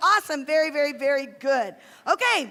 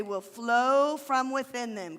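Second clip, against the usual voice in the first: slow recitation; 4 semitones lower, slower than usual. Shown as a demonstration. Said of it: "Can you do that?".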